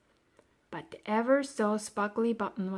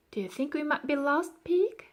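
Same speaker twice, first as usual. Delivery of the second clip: worried; 6 semitones higher, 4 dB louder than usual. A narrator's reading. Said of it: "asked Florentine worriedly".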